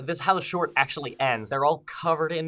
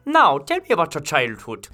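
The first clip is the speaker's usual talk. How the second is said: therapist voice; 8 dB louder than usual. Acted.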